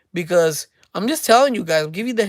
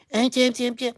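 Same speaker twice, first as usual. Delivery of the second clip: put-on higher-pitched little boy voice; mama's boy voice; 5 semitones higher, 4 dB quieter, much faster than usual. Showing how men who still live at home talk, mock little boy voice. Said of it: "this is how li-, mama's boys".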